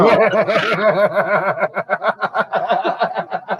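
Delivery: a big burst of real laughter; laughing hard at the memory; loud laugh. Real, not acted. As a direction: crack up out loud.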